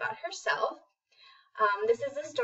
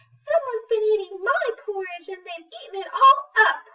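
high, loud, whiny little bear; Baby Bear; 11 semitones higher, 6 dB louder than usual. Acted. A baby bear cries out to his family, high and whiny. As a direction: wail it like an upset cub. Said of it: "And Baby Bear says".

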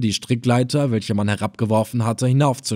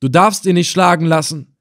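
higher, louder plea; pleading grandson; 6 semitones higher, 4 dB louder than usual. A narrator's reading. Grandson Benil pleading with the emir for his grandfather. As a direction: stand up for grandfather.

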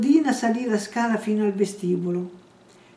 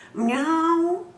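high, drawn-out miaow; cat's meow; 8 semitones higher than usual. A narrator's reading. The cat greets Bloom at the table, meowing high. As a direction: mew up at him like a hungry cat. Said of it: "La gatta rispose miagolando".

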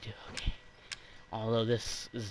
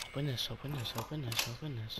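anxious muttering; 6 dB quieter, faster than usual. Real, not acted. Fumbling to open a door.

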